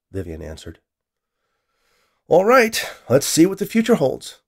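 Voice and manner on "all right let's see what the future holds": high, loud launch of the experiment; excited anticipation. A narrator's reading. Scientist starts his experiment, excited anticipation. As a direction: kick it off eagerly, pitch well up.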